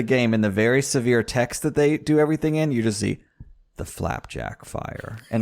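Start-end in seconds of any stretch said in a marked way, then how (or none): 3.8-5.2 Flapjack Fire breathed low and slow; whispered. Shown as a demonstration. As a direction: hush it like a grim title. Title repeated whispered.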